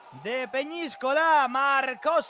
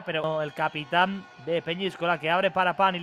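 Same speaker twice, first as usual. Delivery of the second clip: usual speaking voice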